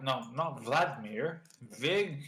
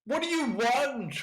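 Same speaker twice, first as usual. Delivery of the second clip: higher, scoffing ship's captain; scornful captain; 10 semitones higher, 6 dB louder than usual. Acted. Captain mocking the pirates' ship name, scornful. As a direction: sneer at the barbaric name.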